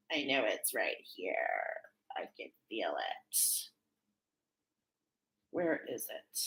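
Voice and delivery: very low, soft self-talk; muttering to herself. Real, not acted. Searching for her note, murmuring to herself that it's here. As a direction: trail down into a low private murmur.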